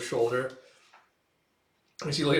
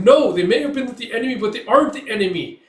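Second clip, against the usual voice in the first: raised higher, louder protest; 9 semitones higher, 9 dB louder than usual. Retold or imitated. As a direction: shout them down in defence. Ozil defends prisoners.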